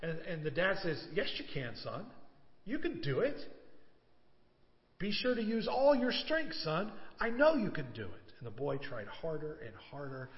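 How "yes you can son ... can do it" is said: encouraging father. A narrator's reading. Father urging son on.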